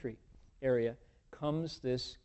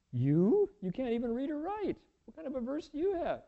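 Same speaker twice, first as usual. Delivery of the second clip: scoffing disbelief; 12 semitones higher than usual. Acted. Monks scoff at illiterate barbarian.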